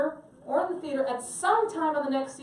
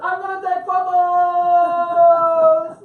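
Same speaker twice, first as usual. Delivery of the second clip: slow, defiant shout, much louder and higher; yelling back; 7 semitones higher, 12 dB louder, much slower than usual. Retold or imitated. Tourist shouting back at the Vatican guards, slow defiant yell. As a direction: bellow it back at the guards, drawn out. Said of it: "I just yelled back to them".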